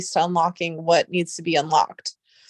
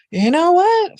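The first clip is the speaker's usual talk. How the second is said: sudden exasperated outburst; 10 semitones higher, 7 dB louder, slower than usual. Real, not acted. Streamer fed up with chat, loud high outburst.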